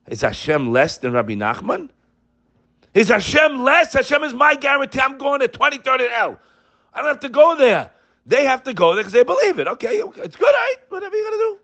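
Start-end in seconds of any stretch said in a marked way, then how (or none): 2.9-9.7 impassioned preacher shouting his faith; fiery preaching. Acted. Preaching at full force. 9.7-11.6 very high, mocking okay with a laughing cry; a touch of scornful laughter; mocking dismissal. Mocking those who go to Uman.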